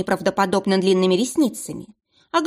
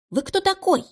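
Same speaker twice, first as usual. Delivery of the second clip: a high, startled little squeak; frightened squeak; 12 semitones higher than usual. A narrator's reading. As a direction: squeak it out in alarm. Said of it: "испуганно пискнула". (испуганный писк)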